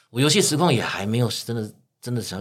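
ordinary talk

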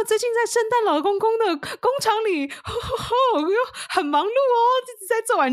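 high, loud chirpy elf in character; cheerful elf voice. Retold or imitated. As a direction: stay sweetly in role as Santa's elf. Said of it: "我说". (高亢欢快的小精灵腔)

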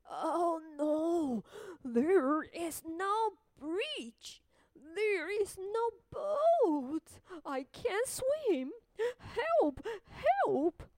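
high, slow, loud panicked wail; scared crying voice. Acted. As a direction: cry for help in panic. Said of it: "The gingerbread man is scared".

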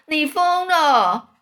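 loud, high, drawn-out exclamation; stretched-out incredulous shout; yelling. A narrator's reading. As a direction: cry out in slow disbelief. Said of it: "蚯蚓大叫说".

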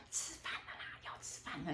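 soft whispered aside voicing dad; whispered imitation of dad. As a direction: mimic dad calling to eat under your breath. Dad calling her to eat.

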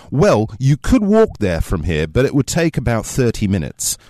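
put-on not too fancy, not too cockney British accent; middle-class British accent. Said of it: "my best sort of middle-class British accent".

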